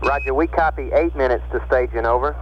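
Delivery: crackly radio transmission voice; tinny voice over a radio link; astronaut radio. Real, not acted. Houston capcom over radio link.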